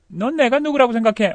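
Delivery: lively everyday question; natural connected question. Shown as a demonstration. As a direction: ask it in one go, lifting 내가. Same sentence as natural question.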